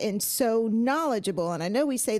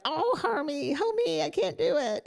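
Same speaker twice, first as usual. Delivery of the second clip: mock funny voice; 7 semitones higher than usual. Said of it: "I can't do it with my normal voice".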